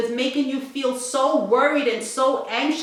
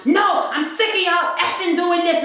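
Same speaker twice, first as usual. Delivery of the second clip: angry customer; 6 semitones higher than usual. Retold or imitated. Customer yelling at the bank teller, mock angry. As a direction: snap back at the teller.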